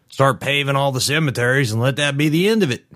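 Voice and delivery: worked-up man making a proclamation; opinionated man. A narrator's reading. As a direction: push the pitch up as if ranting at a rally. Narrator voices angry man.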